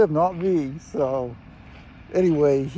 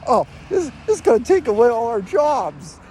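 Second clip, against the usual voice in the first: mock panic; 7 semitones higher, 4 dB louder than usual. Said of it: "and he's freaking out".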